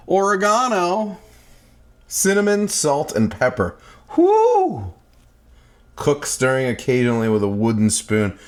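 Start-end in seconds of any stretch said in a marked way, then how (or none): 4.1-4.9 high, loud cheer; excited whoop. Real, not acted. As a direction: let out a happy whoop. Cheer while cooking.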